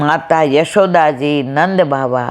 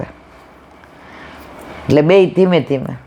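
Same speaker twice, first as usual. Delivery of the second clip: ordinary talk, nothing marked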